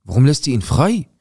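raging disbelief on a phone call; furious at police. Retold or imitated. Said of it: "ich bin durchgedreht".

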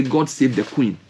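ordinary delivery

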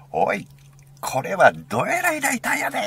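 high, loud Osaka-dialect exclamation; Osaka dialect shout. Retold or imitated. Imitating friend amazed by the lighter, Osaka dialect shout. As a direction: holler it in broad Osaka dialect. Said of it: "大阪弁で".

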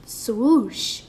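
ordinary delivery

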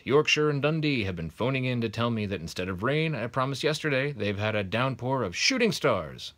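loud, high, chummy TV weather forecast; jolly weatherman. Acted. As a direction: chat to the viewers like a jolly TV weatherman. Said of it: "said the weatherman".

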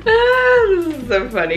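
high burst of laughter; amused laugh. Real, not acted. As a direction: laugh out loud at the letter. Laughs at the letter.

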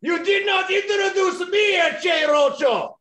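high-pitched mock-outraged luchador yell; indignant shout. Luchador scolding host over his introduction, indignant shout. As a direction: yell it like an insulted wrestler.

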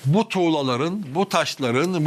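ordinary talk, nothing marked

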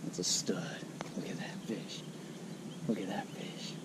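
hushed whisper, almost no voice; whispered awe. Real, not acted. Angler marvelling at the fish in his hands, whispered. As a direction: breathe the words in quiet wonder.

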